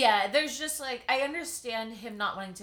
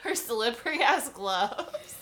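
laughing; 5 semitones higher, 7 dB louder, much slower than usual. Real, not acted. Laughs at the gloves detail.

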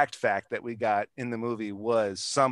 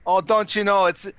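exaggerated Minnesota accent, much higher and louder; comic Minnesota accent, higher and louder; 6 semitones higher, 7 dB louder than usual. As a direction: ham up the Minnesota "don't you know" accent. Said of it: "that Minnesota accent".

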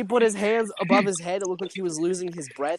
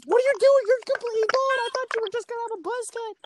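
high shocked mom voice; pitched-up mock alarm; Janet voice; faster than usual. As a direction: squeal it as a startled mother.

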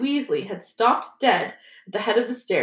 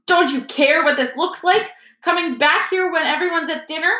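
high, reproachful telling-off; indignant scolding; 8 semitones higher than usual. A narrator's reading. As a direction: scold them with scandalised urgency. Percy scolds the boys.

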